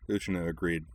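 ordinary delivery, nothing marked

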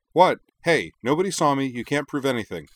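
high, fast, loud protest; startled protest. A narrator's reading. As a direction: blurt out innocence, caught. Caught by the collar.

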